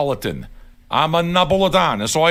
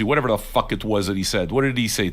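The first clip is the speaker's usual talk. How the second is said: ordinary talk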